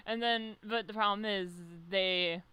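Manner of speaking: normal talking voice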